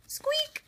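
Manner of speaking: tiny, very high mouse squeak; a bright little squeak. Acted.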